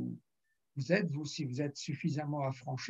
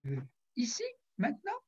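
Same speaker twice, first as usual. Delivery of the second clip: surprised; 8 semitones higher, faster than usual. Retold or imitated. Man surprised by prayer offer.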